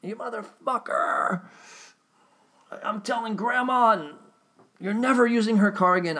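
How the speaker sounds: high, loud mock rant at the son; hammed-up furious old man; imitating dad swearing. Dad yelling at reckless driver son, mock fury. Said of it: "He was swearing at me".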